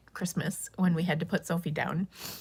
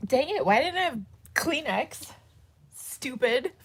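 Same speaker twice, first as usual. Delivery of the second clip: crying frustration; 6 semitones higher than usual. Real, not acted. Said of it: "I'm gonna stop crying now".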